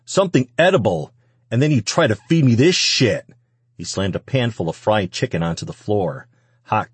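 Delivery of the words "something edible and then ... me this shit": louder, higher, disgusted outburst; angry shouting. A narrator's reading. Man rejecting the food she cooked, angry shouting. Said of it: "the man continued shouting".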